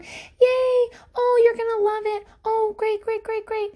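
high, bubbly mock congratulations; gushing well-wisher. Everyone gushing at a pregnant woman, high mock cheer. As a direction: gush like an overexcited friend.

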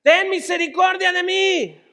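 high, loud plea; desperate shout. Acted. Blind Bartimeo begging Jesús for mercy, desperate shout. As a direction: cry out for mercy at full voice.

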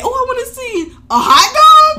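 put-on high and loud, silly kid voice; mock eager kid. Host playing a gullible kid lured by hot dogs, high and loud. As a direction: play the gullible kid, hammed up.

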